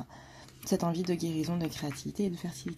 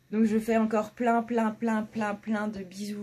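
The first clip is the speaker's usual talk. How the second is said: higher, louder, stretching each plein; gushing kisses; 4 semitones higher, 6 dB louder than usual. Real, not acted. Sending her viewers kisses, gushing and drawn out. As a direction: pile on the pleins like blowing kisses.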